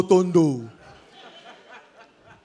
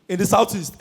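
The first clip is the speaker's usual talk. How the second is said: normal talking voice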